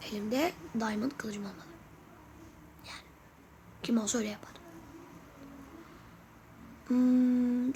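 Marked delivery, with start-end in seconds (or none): 0.1-3.1 whisper. Real, not acted. Jokey cool-looking aside.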